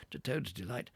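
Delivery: ordinary delivery, nothing marked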